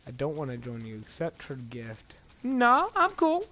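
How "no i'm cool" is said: high, loud, slow silly voice; high mock refusal. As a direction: refuse in a squeaky voice. Mock refusal to game prompt.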